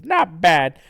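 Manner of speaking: high, loud cocky cartoon fighter; smug Blatchu. Blatchu turns on Sonichu, loudly smug. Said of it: "gets all kinda smug".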